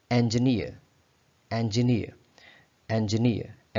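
इंजीनियर said en-juh-neer with an open e; इंजीनियर said clearly for students to copy; repeat after me. Shown as a demonstration. Teacher drilling the class on en-juh-neer. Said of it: "आप मेरे साथ इसको pronounce करेंगे".

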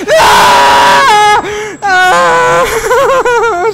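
very high, loud shriek; piercing screams of fright; terrified scream. Real, not acted. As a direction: scream at the jump scare. Jump scare by monster.

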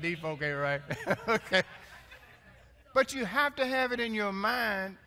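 ordinary talk

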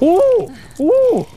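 squealing ooh way up high; high excited yelp. Real, not acted. Player squealing during a fight.

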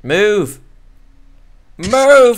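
high, loud yell, building; impatient shouting. Real, not acted. Player orders an obstacle to move, impatient shouting. Yelling at a creature in the way.

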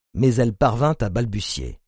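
ordinary delivery

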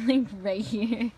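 laughing. Real, not acted. Laughing at her big boots.

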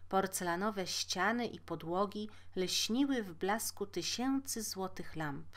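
usual speaking voice

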